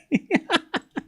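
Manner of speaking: a high, soft giggle trailing off. Real, not acted. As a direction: let a little giggle escape. Laughing at a lucky smash.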